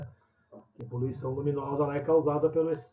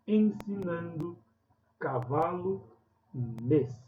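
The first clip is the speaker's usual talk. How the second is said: ditado lento; slower than usual. Shown as a demonstration. Ditando palavras da lista.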